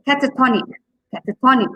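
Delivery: cata-TON-ic, TON hit strongly; stress on second-last syllable. Shown as a demonstration. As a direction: punch the TON in catatonic. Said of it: "second last syllable is stressed".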